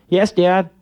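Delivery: put-on bright-pitched, compliant kid reply; obedient little kid. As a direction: answer Dad like a dutiful boy. Obedient kid answers dad.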